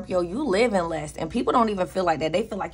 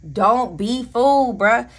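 louder, slow, insistent warning; emphatic warning; 5 semitones higher, 7 dB louder, slower than usual. Real, not acted. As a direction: stop them from being fooled. Warning viewers about Bitcoin.

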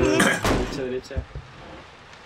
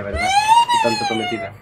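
excited shouting; 3 dB louder than usual. Real, not acted. Shrieking in panic.

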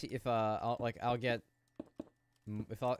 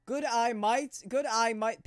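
high, louder mock Australian; Aussie accent; 11 semitones higher, 8 dB louder, slower than usual. Streamer answers the Aussie alert in accent. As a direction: say it like a cartoon Aussie. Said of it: "Aussie alert".

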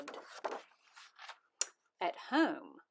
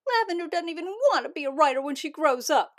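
loud, fast, indignant complaint; upset kid complaining to the teacher; 7 semitones higher, 11 dB louder, faster than usual. Acted. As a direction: blurt it out in protest. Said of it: "complained Poppy".

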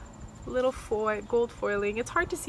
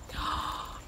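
soft gasp of delight; 8 dB quieter than usual. Real, not acted. Seeing the planner gift, delighted gasp. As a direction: breathe in sharply, thrilled.

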